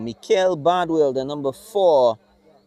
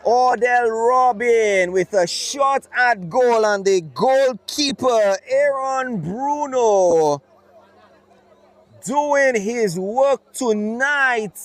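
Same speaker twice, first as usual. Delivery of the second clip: excited; 7 semitones higher, slower than usual. Real, not acted. Big save commentary after shot.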